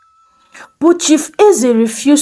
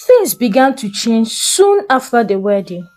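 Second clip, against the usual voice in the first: ordinary delivery, nothing marked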